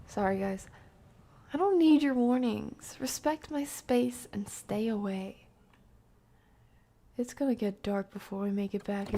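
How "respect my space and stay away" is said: normal talking voice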